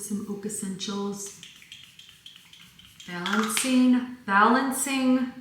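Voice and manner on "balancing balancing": the word Balancing with a Michigan vowel, drawn out slowly; slow Michigan-accented Balancing. Real, not acted. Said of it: "the one word I can still hear my Michigan accent".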